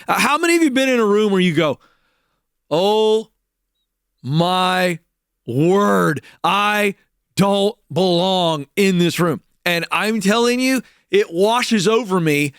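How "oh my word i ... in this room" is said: slow dismay. Retold or imitated. Feeling out of place in room.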